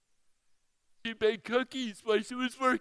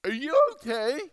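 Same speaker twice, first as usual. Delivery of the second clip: imitating the drive-thru girl; 6 semitones higher, 10 dB louder than usual.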